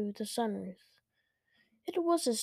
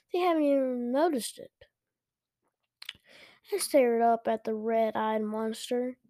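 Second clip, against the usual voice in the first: ordinary delivery, nothing marked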